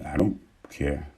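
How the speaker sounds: calm indifferent murmur; nonchalant murmur. Retold or imitated. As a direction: let it drop like it's nothing. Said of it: "looking at him like".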